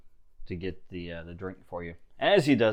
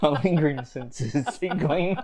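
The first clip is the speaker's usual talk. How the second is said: laughing; 6 semitones higher, 4 dB louder than usual. Acted. Joking about sensing.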